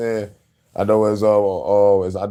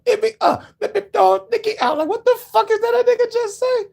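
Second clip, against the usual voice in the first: much higher mock rapper voice; squeaky mock rapper. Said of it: "when [name] came up".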